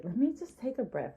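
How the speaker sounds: ordinary talk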